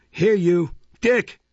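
loud, high summoning call. A narrator's reading. Matron calling little Dick to come, loudly. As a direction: call a child sharply from another room. Mrs Mann calls the boy.

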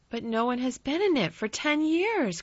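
high-pitched protest; Colin crying out. A narrator's reading. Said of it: "cried Colin".

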